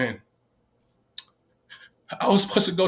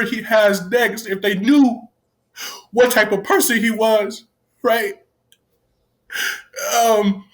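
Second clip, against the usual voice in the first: real tearful, higher and louder voice; crying; 6 semitones higher, 4 dB louder than usual. Real, not acted. Staff member crying as he tells viewers about a kid he left. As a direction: choke through it, fighting tears. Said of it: "y'all see me cry right now".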